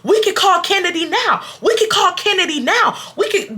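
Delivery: high-pitched mock yell; whiny, repeated arguing shout; imitated yelling. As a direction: mimic someone insisting in a quarrel. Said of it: "Kaka is yelling at me".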